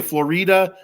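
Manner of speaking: "Florida" said flo-REE-da; Spanish-style Florida. Shown as a demonstration. Host admitting his habitual slip, Spanish-style flo-REE-da for "Florida". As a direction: stress the middle of "Florida" as a long REE. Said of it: "I always wanna say".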